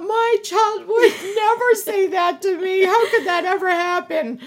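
high, loud retold parental shock; mock disbelief. Mom recalling hearing "I hate you", high mock disbelief.

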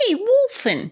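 Wolfen as "Wolfen" in a high, loud hammed-up New York accent. Mock New York accent to the other host. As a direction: call out like a mock New Yorker. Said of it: "terrible New York accent".

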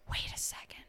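breathless dramatic whisper; gasp, then whisper it. Acted. Mock dramatic realization.

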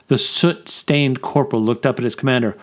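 ordinary delivery

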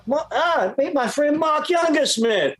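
high, slow imitated English accent. English producer introducing his friend to everybody, high English accent. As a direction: mimic an Englishman making a grand introduction. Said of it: "He'd go".

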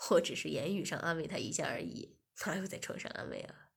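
lightly voiced master pleading his excuse; low master voice. Master excusing himself to Shiya, low and coaxing.

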